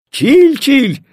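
high, happy call to a grandson; smiling delight. A narrator's reading. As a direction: grandfather greeting with a smile. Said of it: "заулыбался дедушка". (радостно, с улыбкой)

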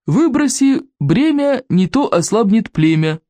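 slow, high, singsong saying with a smirk; smirking proverb. A narrator's reading. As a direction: intone the motto with smug relish. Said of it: "ухмыльнулся вождь берсерков".

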